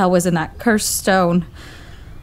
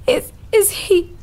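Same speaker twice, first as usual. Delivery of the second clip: softer, higher, crying words; trembling tearful question; tearful shock; 12 semitones higher, 3 dB quieter than usual. Acted. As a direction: fight back tears as you ask. Woman shaken after death.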